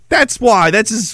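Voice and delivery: ordinary talk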